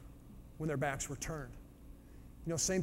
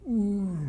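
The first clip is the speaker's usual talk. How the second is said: loud mock roar like a lion; 5 semitones higher, 9 dB louder than usual. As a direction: roar it out like the king of the jungle. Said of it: "A big roar".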